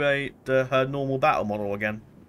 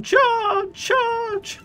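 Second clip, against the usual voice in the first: voice cracking; 5 dB louder than usual. Real, not acted. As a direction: voice cracks way up on the shout. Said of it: "that wasn't intentional voice crack".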